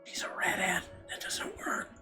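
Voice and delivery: soft whisper; whispered aside. Acted. Aside within the narration.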